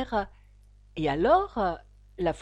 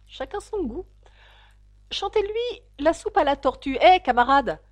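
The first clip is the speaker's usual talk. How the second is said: slightly offended; 7 semitones higher, 6 dB louder than usual. Acted. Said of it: "d'un air un peu offensé".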